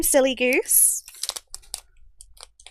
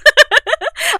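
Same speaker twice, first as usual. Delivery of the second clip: high, loud laughter; loud, high giggling fit; giggle; 10 dB louder than usual. Real, not acted. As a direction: burst into giggles. Laughs at her own reenactment.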